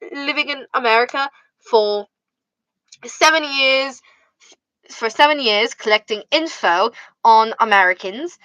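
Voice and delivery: hammed-up British accent, measured pauses. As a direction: confess it grandly with a British accent. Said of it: "I just started doing a British accent".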